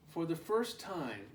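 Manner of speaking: ordinary delivery, nothing marked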